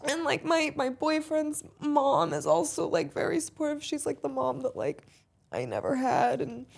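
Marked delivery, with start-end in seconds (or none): none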